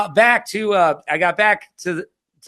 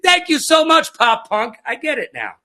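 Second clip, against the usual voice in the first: mock epiphany; 11 semitones higher than usual. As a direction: shout it out like it all finally clicks. Excited realization, mock epiphany.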